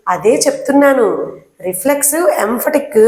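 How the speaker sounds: ordinary talk, nothing marked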